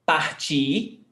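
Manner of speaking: Partir with the final syllable stressed; stress on last syllable. Shown as a demonstration. Teacher answering a student's question on the infinitive, last-syllable stress. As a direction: lean on the end of Partir, the "tir". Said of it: "pronounce the infinitive form".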